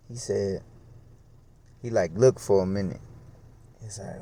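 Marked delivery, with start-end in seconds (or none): none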